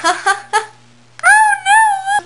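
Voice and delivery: high-pitched drawn-out wail of dismay; mock dismay. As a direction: wail it in exaggerated horror. Acting out waking up late.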